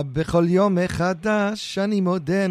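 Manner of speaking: imitating a deep Israeli accent, slow; mock the Israeli Hebrew pronunciation. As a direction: Hebrew sung slowly in a thick Israeli accent. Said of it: "an Israeli, you know, deep accent".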